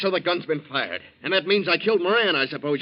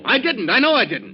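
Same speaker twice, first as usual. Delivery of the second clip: heated denial; 6 semitones higher, 5 dB louder than usual. Acted. Accused man protesting.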